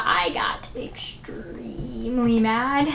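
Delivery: slow, soft unvoiced whisper. Real, not acted. Telling viewers about the deleted story, slow whisper. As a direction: breathe it out slowly, almost silent. Retelling getting angry at brother.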